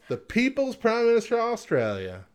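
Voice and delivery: high, grand title call; hammed-up boxing-ring announcement; announcer voice.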